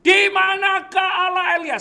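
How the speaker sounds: loud, high, long-held yell; shouted cry. Acted. Preacher acting Elisa at the river, loud high shout. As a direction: call out to heaven at full voice. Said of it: "Elisa teriak".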